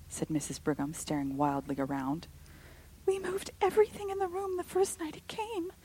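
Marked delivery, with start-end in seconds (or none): 3.1-5.7 high, tense and insistent; high fearful voice. A narrator's reading. Rebecca insisting.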